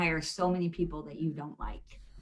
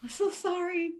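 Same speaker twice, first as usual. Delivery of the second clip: sheepish apology; 9 semitones higher, 3 dB quieter than usual. Retold or imitated. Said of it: "I was like".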